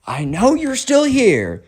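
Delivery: drawn-out, high calling out; mock yell. Ty calls to the intruder hiding in the house, mock yell. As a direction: call through the house slowly, without real volume. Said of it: "that's when Ty yells out".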